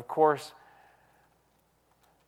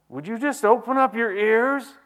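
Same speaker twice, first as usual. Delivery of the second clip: higher, louder fiery preacher cry; John the Baptist preaching; 9 semitones higher, 8 dB louder than usual. Pretend. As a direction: blast it like a prophet in the desert. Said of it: "John the Baptist would probably preach that a little differently".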